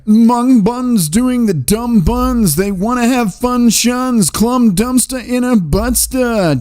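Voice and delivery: high, very slow singsong nonsense voice; silly reading. Acted. Streamer reads a viewer's nonsense rhyme, goofy and high. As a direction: drag out the gibberish in a goofy high voice.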